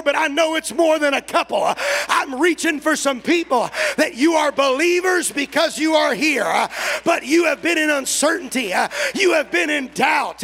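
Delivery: loud, rolling preacher's cadence with an uh on every breath; preaching at full force. Acted. Preacher calling out doubters in the church, full-force preaching. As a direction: pray and preach over the church in full cry. Prays then preaches to congregation.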